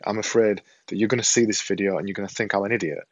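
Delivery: usual speaking voice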